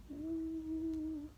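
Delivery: ordinary delivery, nothing marked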